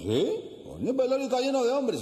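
high-pitched, emphatic insistence; insistent friend. Acted. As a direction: push the point with pitch way up. Insisting in retold joke.

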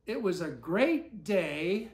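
ordinary delivery, nothing marked

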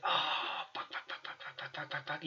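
frantic unvoiced swearing under the breath; panicked whisper. Player hiding from the owlbear mate, frantic whispered swearing. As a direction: whisper it in rapid panic, barely audible. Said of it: "And I was like".

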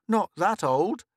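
reasonable boy, lightly voiced; sensibly. Boy reasoning with Mink, sensible. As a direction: reason with her calmly. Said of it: "said Joe sensibly".

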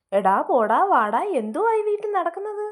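indignant scolding that jumps high on the question; an outraged mother-in-law scolding her. Acted. Mother-in-law scolds the new bride, indignant.

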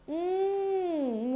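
ordinary delivery

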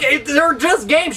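ordinary talk, nothing marked

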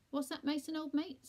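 put-on loud, high-pitched old pal; deaf friend. A narrator's reading. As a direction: call out as if you can't hear. Deaf old Percy asks.

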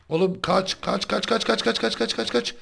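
high, rapid run-run-run; frantic high chant of kaç; urgent shouts. Real, not acted. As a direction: urge him to flee. Fleeing monsters, shouting run.

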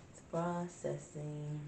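very soft, slow, stretched-out words; drawn out. Real, not acted. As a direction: draw it out softly while waiting. Idly repeating while app processes.

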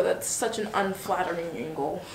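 usual speaking voice

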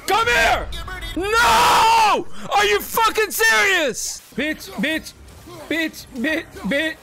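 high furious screaming; raging gamer. Real, not acted. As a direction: scream it in a frenzy. Frantic fight screaming.

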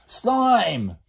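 high, loud one-word yell; shouted call. Acted. Boy summons Slime again, shouted call. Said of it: "the boy shouted again".

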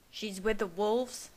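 usual speaking voice

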